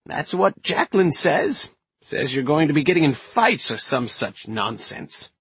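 put-on high-pitched feisty old lady character; feisty old woman. Inez explains why they came, feisty old woman's voice.

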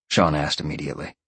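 ordinary delivery, nothing marked

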